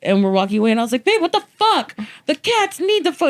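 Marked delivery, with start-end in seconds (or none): 1.1-3.3 much higher indignant whine; whiny protest. Retold or imitated. Girlfriend protesting to boyfriend about the cats, high whine. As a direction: plead like it's outrageous.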